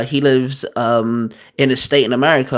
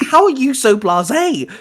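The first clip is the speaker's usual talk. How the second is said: high-pitched baffled question; incredulous; 10 semitones higher than usual. Retold or imitated. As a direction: replay disbelief at a friend's calm. Retelling disbelief at calm friend.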